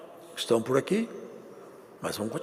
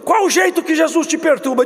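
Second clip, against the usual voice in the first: the same question pitched higher and called out louder; louder repeat; 12 semitones higher, 7 dB louder than usual. Shown as a demonstration. Pastor repeats the question to the congregation, shouted higher. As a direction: hammer the question home to the church. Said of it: "Hoje tem uma pergunta nesse culto".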